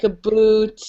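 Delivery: slow "a boot" with oo for ow; "a boot" said slowly as a funny Canadian OU. Shown as a demonstration. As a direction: draw out "a boot" to rhyme with toot. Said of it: "we say words that have O U's in them very funny".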